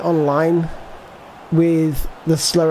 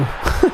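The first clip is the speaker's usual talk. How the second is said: brief breathy chuckle; real chuckle, mostly air; short laugh. Real, not acted. Player laughing at terrible defending, brief chuckle.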